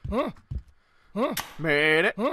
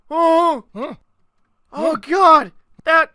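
usual speaking voice

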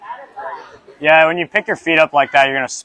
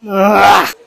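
loud high anguished ugh; frustrated groan; 7 semitones higher, 8 dB louder than usual. Real, not acted. Gymnast groaning after another bad attempt.

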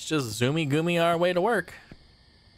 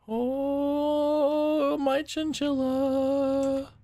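stunned; 8 semitones higher, much slower than usual. Real, not acted. Sees something frightening.

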